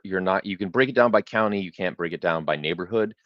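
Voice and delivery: normal talking voice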